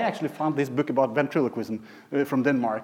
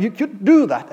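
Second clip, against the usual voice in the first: raised, higher mock yell; retold yelling; 7 semitones higher, 6 dB louder than usual. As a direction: re-enact yelling at the teddy. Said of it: "I was yelling at him".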